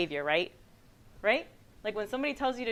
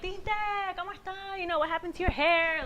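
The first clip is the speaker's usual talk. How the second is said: higher, playful retold ribbing; joking tease; 9 semitones higher than usual. Sister to red-haired brother, joking tease. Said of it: "just make jokes".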